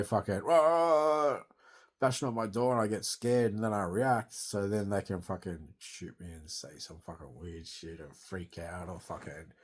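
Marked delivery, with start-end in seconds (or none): none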